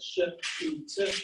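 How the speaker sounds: "sh, oo, t" sounded out one by one; separate phonemes. Shown as a demonstration. Lecturer breaking shoot into its sounds, separate phonemes. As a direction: say each sound of "sh, oo, t" apart. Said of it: "produce the sh sound".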